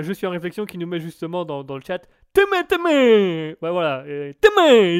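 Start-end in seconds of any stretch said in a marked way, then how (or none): 2.3-3.5 slow, high, loud call. Real, not acted. Greeting viewer in chat. 4.4-5.0 slow, high, loud call. Real, not acted. Greeting viewer in chat.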